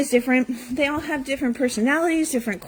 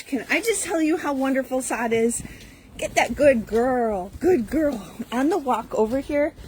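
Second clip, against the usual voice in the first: usual speaking voice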